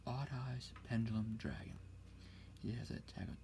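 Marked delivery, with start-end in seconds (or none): none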